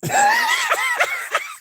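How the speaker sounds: a big, high burst of laughter. Real, not acted. Streamer cracks up at clip.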